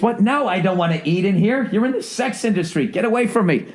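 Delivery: high, put-on prissy refusal; mock disgust. Guy refusing strip club food, mock squeamish disgust. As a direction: recoil from the sleazy waitress, squeamish.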